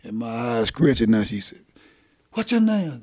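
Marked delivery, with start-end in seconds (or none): 2.3-3.0 female officer voice. Retold or imitated. Female officer questions him.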